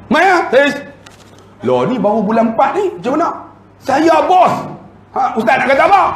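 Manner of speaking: high-pitched, indignant challenge; irate bossy woman. Acted. Boss challenging the ustaz over leave, angry high voice.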